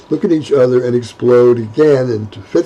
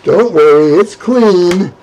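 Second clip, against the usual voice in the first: sputtering laugh; 5 semitones higher, 5 dB louder, slower than usual. Acted. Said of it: "sputtering through laughter".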